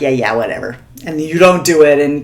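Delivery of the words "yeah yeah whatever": dismissive brush-off. Retold or imitated. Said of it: "and you're like".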